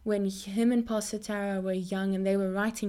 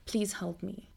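soft retold prayer; hushed plea; 2 semitones lower, 7 dB quieter than usual. Preacher recalling her only prayer on bad days, hushed plea. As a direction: plead quietly, voice dropped.

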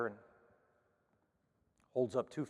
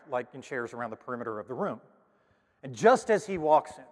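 ordinary talk

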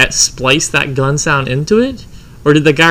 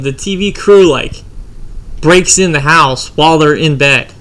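ordinary talk

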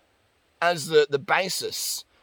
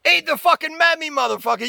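exasperated yell; 9 semitones higher, 4 dB louder than usual. Retold or imitated. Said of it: "And it's like".

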